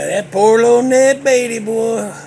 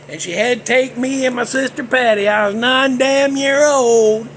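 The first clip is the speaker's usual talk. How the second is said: normal talking voice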